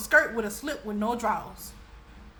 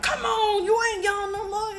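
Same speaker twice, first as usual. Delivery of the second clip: uncle's rebuke; 10 semitones higher, 4 dB louder than usual. Retold or imitated. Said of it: "he was like".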